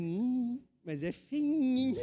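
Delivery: slow, teasing mock jab; teasing brother. Pastor imitating his brother teasing about the newborn, slow and teasing. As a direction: tease it like a joking brother. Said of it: "O meu irmão chega assim e fala assim".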